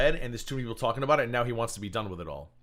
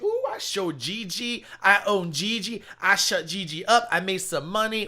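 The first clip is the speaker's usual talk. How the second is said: much higher, slow, smug put-on voice; mocking the rival; 8 semitones higher, 5 dB louder, slower than usual. Mocking the rival who thinks he won, high smug drawl. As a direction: ham up a preening braggart, high and slow.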